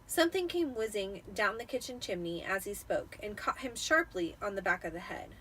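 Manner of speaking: plain reading voice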